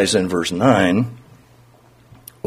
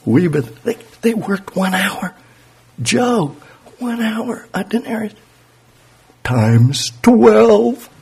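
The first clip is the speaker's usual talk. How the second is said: high, incredulous worker doing the sums; a hint of an offended laborer adding it up; indignant worker; 9 semitones higher, slower than usual. Early worker calculating pay.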